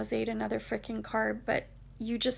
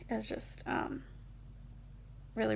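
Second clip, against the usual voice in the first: ordinary talk, nothing marked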